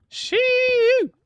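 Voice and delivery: a high, shrill yelp held long; hillbilly whoop. Retold or imitated. Mocking the hill folk of East Tennessee with a high whoop. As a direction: whoop like a cartoon hill-country local. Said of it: "stereotypical Midwest accent".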